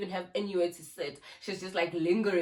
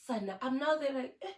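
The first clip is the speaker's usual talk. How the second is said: ordinary talk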